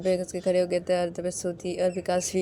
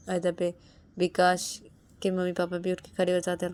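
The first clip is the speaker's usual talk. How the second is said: ordinary delivery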